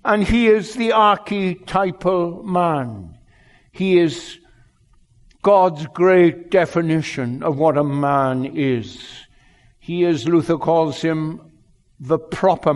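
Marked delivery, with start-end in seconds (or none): none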